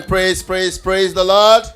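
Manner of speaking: ordinary delivery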